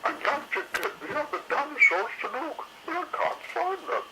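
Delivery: high fretting lady dinosaur; fussy Missus Carey. A narrator's reading. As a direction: complain like a put-upon mother dinosaur.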